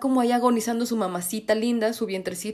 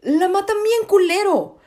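outraged; 8 semitones higher, 4 dB louder than usual. Real, not acted. Outraged about the deer scene.

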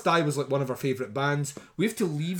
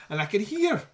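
normal talking voice

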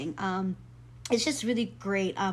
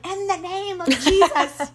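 loud, high commanding rebuke; hammed-up casting out a demon; mock rebuke; 8 semitones higher, 9 dB louder than usual. Rebuking the owl as a demon, loud mock command.

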